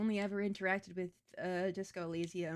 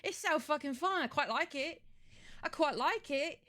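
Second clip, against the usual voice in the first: hammed-up British accent, mostly pitched higher; an American's idea of a British person, played up; 4 semitones higher than usual. Streamer who can't resist it, in a hammed-up British accent. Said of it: "likes to use a British accent".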